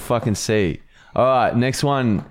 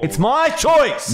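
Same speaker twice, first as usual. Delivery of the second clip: high, drawn-out protest; freaking out; 13 semitones higher, slower than usual. Real, not acted. Co-host insists the clip be cut, freaking out. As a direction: freak out, pitched way up and slow. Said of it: "cut me freaking out".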